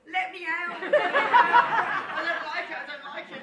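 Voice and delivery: high, loud mock panic, laughing through it; scared Chris. Best man imitating Chris begging to get off the ride, squealed laughing panic. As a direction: squeal like a terrified man on a big wheel. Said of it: "He was like".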